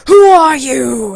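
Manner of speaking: very loud, slow, drawn-out demand; booming troll challenge. Retold or imitated. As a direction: imitate a troll challenging a traveler grandly. Troll challenges Alice at bridge.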